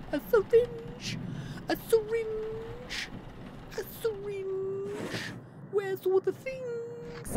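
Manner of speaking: very high, slow falsetto stretching "A syringe!"; squeaky falsetto. Pretend. Silly repeat of found item.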